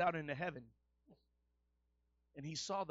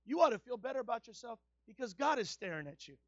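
loud, ringing preacher's cry; shouting sermon climax; full-force preaching; 7 semitones higher, 17 dB louder than usual. Acted. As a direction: preach it out to the whole room, full voice.

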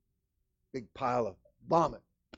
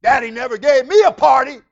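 loud, high complaint of a sulking son; pouting elder brother; 11 semitones higher, 17 dB louder than usual. Acted. Preacher voices the pouting brother complaining to father. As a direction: whine it as the jealous son. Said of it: "this pouting elder brother".

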